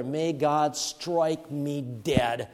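drawn-out, heavy oath; preacher voicing Peter's curse, very slow; slow mock curse. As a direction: stretch out the curse slowly. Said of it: "this is what the cursing swear".

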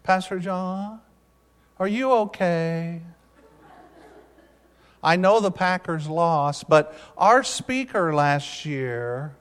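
soft, slow impression of a young camper; ten-year-old camper. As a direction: imitate an earnest boy drawing out each word. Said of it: "now this is how he talked".

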